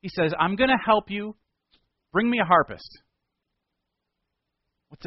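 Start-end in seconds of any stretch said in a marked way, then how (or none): 0.4-3.0 commanding request. Acted. Elisha asks for a harpist.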